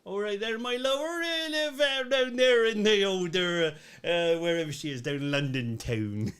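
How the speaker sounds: hammed-up Cornish, rising high and stretched out; Cornish accent. Shown as a demonstration. Co-host showing off on request, exaggerated Cornish accent. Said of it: "Yeah, yeah, I can do that".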